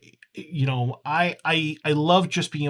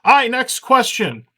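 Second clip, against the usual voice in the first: announcer call; 9 semitones higher, 7 dB louder than usual. Acted. Announcer voice kept going.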